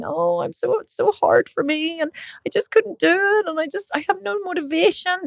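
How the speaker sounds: high, whiny mock complainer; mock whining. Mocking people who complain about motivation, high and whiny. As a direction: parody a moaning gym-dodger, high and whiny. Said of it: "we walk around suffering".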